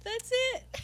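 high-pitched laughing words. Real, not acted. Laughing hard at comparison.

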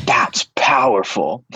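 slow, hushed whisper of awe; the kid whispering back, amazed; awed whisper. Retold or imitated. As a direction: breathe it out, stunned.

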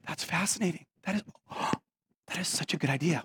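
very soft, mock-awed hush; patronizing awe. Tour guide humoring a guest's idea, hushed and patronizing. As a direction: hush it as if overwhelmed. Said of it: "she was just being patronizing".

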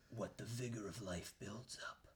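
low whispered ominous reading; eerie whisper. Acted. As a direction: read the card like a curse. Reading card text eerily.

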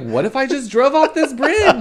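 giggly hypothetical. Retold or imitated. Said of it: "be driving and be like".

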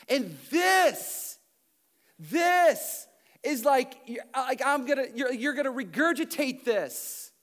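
loud, driving pulpit preaching; preaching at full force. Acted. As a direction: preach it hard to the whole room.

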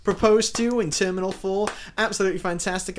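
high, loud mock on-air announcement; hammed-up DJ reading a listener message; airport radio DJ. Pretend DJ announcing a listener's engagement, big excited radio voice. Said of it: "a radio D J for an airport".

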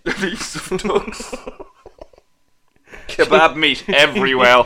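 slow, drawn-out deadpan mockery; mock dramatic. Mocking her sister's bath accident, slow deadpan drawl. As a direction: savour each word like a grim headline.

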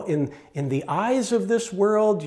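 ordinary talk, nothing marked

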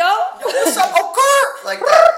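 put-on very high, loud falsetto girl voice; shrieky sassy girl impression; man doing a woman's voice. Shown as a demonstration. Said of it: "I'm not perfecting that".

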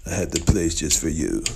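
ordinary talk, nothing marked

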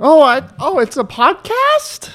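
high drawn-out squeal of fake realization; slow, high-pitched fake amazement; mock surprise. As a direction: act astonished, stretching it way up high. Mock surprise at being recorded.

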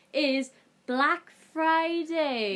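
slow drawn-out title announce; announcing. Acted. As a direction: present it like a show host.

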